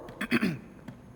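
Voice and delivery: a timid little throat clear before speaking; nervous throat clear. Acted. Nervous actor clears throat before a squeaky line.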